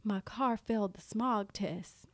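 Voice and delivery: mock glum. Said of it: "He's like".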